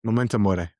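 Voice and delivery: Latin phrase in a tapped, accented "mori"; cooler accent. Real, not acted. Co-host answers with the quote, cooler accent. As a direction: say "Memento mori" with an accented, near "mo-deh" ending. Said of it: "you say it with a much cooler accent".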